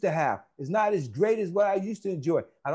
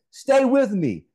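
calling out; 4 semitones higher, 6 dB louder, slower than usual. Real, not acted. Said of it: "calls congregation's attention".

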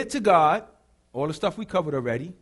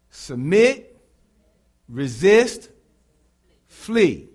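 Submit, resist, flee each hit loud and separate; loud rhythmic call of Submit, resist, flee; punched words; 6 dB louder, much slower than usual. Shown as a demonstration. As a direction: call out the three words loud for the church to repeat. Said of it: "Come again".

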